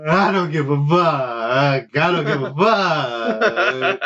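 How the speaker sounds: slow, drawn-out dismissive retort. Retold or imitated. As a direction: drag it out, unbothered.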